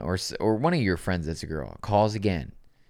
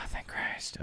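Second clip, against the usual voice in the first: breathy whispered relief; 11 dB quieter than usual. Real, not acted. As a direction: sigh it out in a whisper. Sighing relief.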